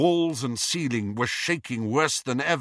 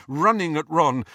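ordinary delivery, nothing marked

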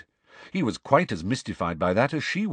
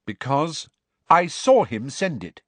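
firmly raised, a touch angry; 7 semitones higher, 5 dB louder than usual. A narrator's reading. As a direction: stand up for him sharply. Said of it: "said Cho angrily".

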